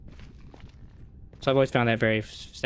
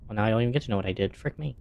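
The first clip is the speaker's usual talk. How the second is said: ordinary delivery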